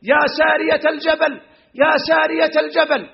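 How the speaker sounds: a mock rally cry from the pulpit, not full volume; Umar's call to Sariya, lightly voiced; retold call out. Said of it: "ثم ينادي بصوت مرتفع".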